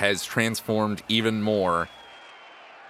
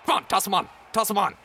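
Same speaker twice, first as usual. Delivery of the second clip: eager goblin clown; 12 semitones higher, much faster than usual. Acted. Goblin clown urging the player to load the kid, eager. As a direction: hurry them along, bouncing.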